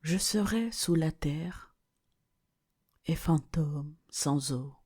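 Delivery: level recitation broken by pauses; measured verse recitation. Acted. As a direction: recite it in separate, weighted phrases. Quotes verse, slowly with pauses.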